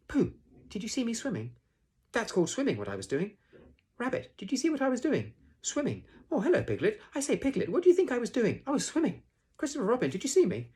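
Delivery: put-on very high, loud childlike chatter; Roo squeaking excitedly. A narrator's reading. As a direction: squeal to one listener after another for attention. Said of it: "squeaked Roo excitedly".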